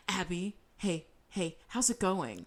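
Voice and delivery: soft, slow mock-casual hello; hushed, careful check-in; tentative greeting. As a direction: imitate someone gingerly interrupting. Mom pokes head into rehearsal.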